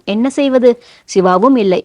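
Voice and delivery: usual speaking voice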